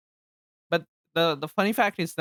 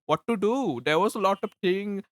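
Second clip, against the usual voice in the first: put-on Hollywood Indian accent; 6 semitones higher than usual. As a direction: mimic the movie version of an Indian accent. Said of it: "the Indian in Hollywood accent".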